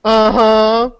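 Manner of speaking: normal talking voice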